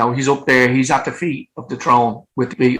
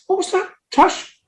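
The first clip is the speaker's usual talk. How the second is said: startled high question; surprised. Retold or imitated. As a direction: snap round in disbelief. Startled, asking who walked past.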